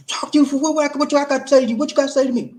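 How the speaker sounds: hammed-up furious husband, high and loud; angry husband. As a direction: play the hostile man who doesn't want to talk, loud and high. Role-play of an angry husband.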